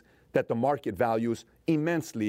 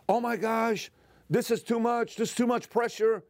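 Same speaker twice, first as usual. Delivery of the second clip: much higher, whiny mock complaint; mock overwhelmed person; 10 semitones higher than usual. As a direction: parody someone who buckles under pressure. Mocking someone overwhelmed by pressure.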